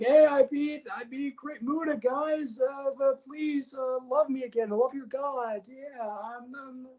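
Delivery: high, goofy whiny voice; high, goofy whiny voice of Kazuchika Okada; Okada impression. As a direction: play a needy clown begging for love. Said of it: "Kazuchika Okada comes out and he's like".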